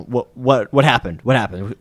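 deep, gruff alarmed questions; panicked gruff voice. Acted. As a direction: bark it out low and alarmed. Drifter panics at the group.

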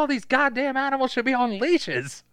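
high put-on irritated voice; exasperated scout impression. Scout fed up with the chickens, high mock exasperation. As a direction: gripe as a city scout among farm animals.

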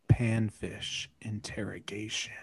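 soft mock-sexy whisper; hushed, pretend-sultry reading; OnlyFans voice. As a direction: whisper it seductively into the mic. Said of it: "in your best OnlyFans voice".

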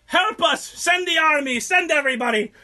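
high, loud pleading cries; hammed-up frantic shouting; mock desperate plea. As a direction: yell for help like a panicked city. Frantic plea for help, retold.

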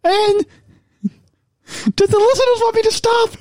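put-on high-pitched voice. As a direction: tease in a silly high voice. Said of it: "talk in my high voice".

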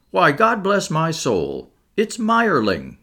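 high, drawn-out astonishment; astonished recognition. A narrator's reading. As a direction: let the name dawn on you in amazement. Inspector suddenly recognises the dead man.

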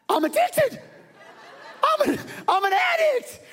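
a sudden high shriek, laughing at himself; mock shrieking confession. Preacher imitating his calm friend, now a wild laughing shriek.

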